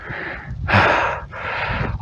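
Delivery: long, winded exhale after the climb; heavy breathless sigh. Real, not acted. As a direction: blow out a tired breath. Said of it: "I'm a bit out of breath".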